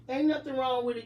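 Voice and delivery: ordinary talk, nothing marked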